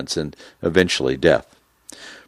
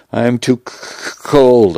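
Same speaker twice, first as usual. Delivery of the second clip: shivering, teeth chattering; 5 semitones higher, slower than usual. A narrator's reading. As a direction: stammer through chattering teeth. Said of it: "my teeth chattering".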